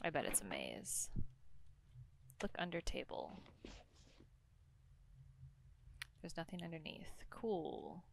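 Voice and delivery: quiet and low, creeping through; hushed. Real, not acted. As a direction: hush it as if something could hear. Exploring a spooky maze.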